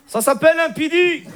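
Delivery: put-on high-pitched Moroccan Arabic accent. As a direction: pose as French with a thick Moroccan accent. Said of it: "t'as l'accent".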